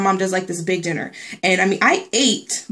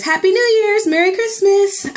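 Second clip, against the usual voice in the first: high, sing-song holiday wishes; bouncy, high festive wishes; cheery holiday greeting; 12 semitones higher, 4 dB louder than usual. Real, not acted. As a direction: sing out the greetings brightly to the viewers.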